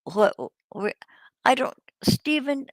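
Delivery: usual speaking voice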